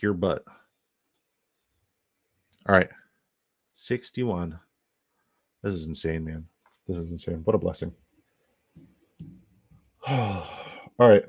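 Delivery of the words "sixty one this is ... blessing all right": usual speaking voice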